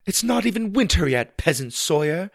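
high, haughty princess voice. Acted. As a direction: call back as a grand princess. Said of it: "Susie called back in her princess voice".

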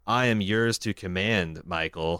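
low, soft and slow prim robot; bow to him like a prim machine; prissy robot voice. Retold or imitated. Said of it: "And Kit says".

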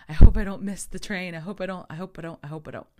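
high, loud fretting that fades; mock panic. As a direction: repeat it like an anxious chant, dying down. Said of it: "in full panic mode".

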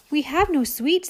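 high, tiny ants' voice; tiny ants. Acted. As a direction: answer in a small, timid chorus. Ants reply.

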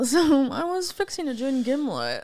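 lightly voiced snob with teeth together; a hint of a snooty rich person; snobby voice. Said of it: "So the snobby voice".

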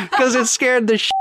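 laughing through the words, pitched high. Real, not acted. Explaining his scared yell to listeners, laughing. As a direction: let real laughter spill into the explanation.

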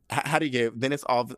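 ordinary delivery

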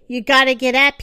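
loud, urgent plea to get up; urgent call. Acted. Great prince urges wounded Bambi to stand, loud and urgent. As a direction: push him to his feet. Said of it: "urging him to stand".

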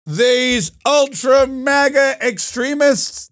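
put-on high, slow old man speechifying; hammed-up, laboured old-timer oratory; Joe Biden. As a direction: denounce them like a doddering president. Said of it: "Joe Biden goes out there and goes".

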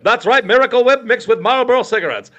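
chipper sponsor read. Retold or imitated. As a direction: read it like a radio sponsor spot.

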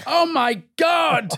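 slow, high drawn-out gasp of awe; awestruck. Retold or imitated. Actor awed by famous soundstage names. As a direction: stretch it out in starstruck wonder.

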